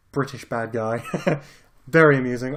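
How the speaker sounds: ordinary talk, nothing marked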